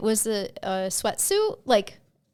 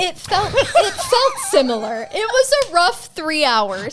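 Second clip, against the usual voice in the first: put-on Valley Girl voice; 8 semitones higher, 8 dB louder than usual. Teasing her co-host with the Valley Girl voice. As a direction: play the ditzy Valley Girl telling the story. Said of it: "You do that way too well".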